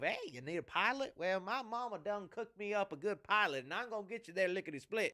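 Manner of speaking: hammed-up Southern twang; terrible Southern accent. Mocking the actor's pilot pitch, terrible Southern accent. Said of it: "this really terrible Southern accent".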